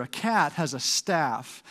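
normal talking voice

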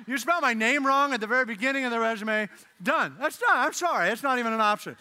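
emphatic comic exasperation; mock indignant rejection. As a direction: dismiss the applicant in mock outrage. Acted rejection of misspelt resume.

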